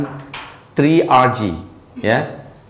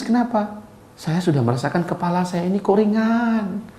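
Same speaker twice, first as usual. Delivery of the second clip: higher, much softer voice of a patient; imitated tearful patient; 7 semitones higher, 8 dB quieter than usual. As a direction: mildly imitate a weak, amazed patient. Menirukan pasien yang baru menangis. (menirukan pasien, suara tinggi dan lirih)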